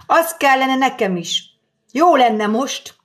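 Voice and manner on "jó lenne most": usual speaking voice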